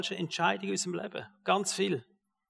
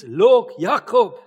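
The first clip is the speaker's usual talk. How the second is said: a sudden loud cry calling Jacob a liar; accusing shout at Jacob; loud accusation; 4 semitones higher, 9 dB louder, much slower than usual. Acted. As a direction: call him out loud and sharp. Preacher calls out Jacob's lie.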